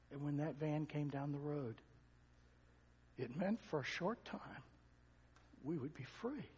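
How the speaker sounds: softly voiced, heavy with memory; hushed retold testimony. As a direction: tell it hushed, as she confided it. Abused girl's testimony retold.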